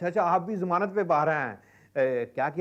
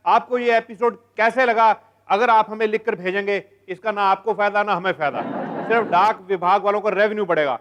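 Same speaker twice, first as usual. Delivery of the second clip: loud, projected sign-off; show announcer; 5 semitones higher, 7 dB louder than usual. Acted. Host closing the programme till next week, loud announcer delivery. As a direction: announce it big, stretching the show's name at the end.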